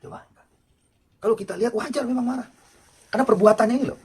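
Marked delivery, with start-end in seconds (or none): 1.2-4.0 loud, high impassioned sermon; preacher heating up over the sinner's deed; fiery preaching. Acted. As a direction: preach it out at full pitch. Preacher rising to full force.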